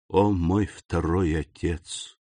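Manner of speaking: slow, hushed address to the dead; reverent invocation. A narrator's reading. Count kneeling to his second father, slow reverent invocation. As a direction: begin a solemn prayer, unhurried.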